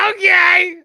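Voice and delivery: high, loud exclamation; sudden shout. Pretend. Host bursting in before the song list, high shout. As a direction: belt it out in a burst.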